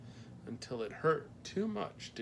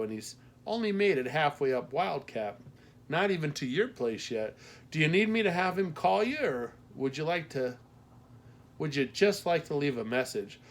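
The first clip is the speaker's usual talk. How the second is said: lightly voiced Midwestern postmaster, louder and higher; Midwestern accent; 5 semitones higher, 6 dB louder than usual. A narrator's reading. Postmaster tells caller Tony isn't back, Midwestern accent. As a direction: talk like a helpful Midwestern matron. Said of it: "her Midwestern accent left no doubt".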